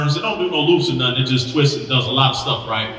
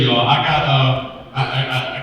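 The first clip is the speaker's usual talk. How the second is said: ordinary talk, nothing marked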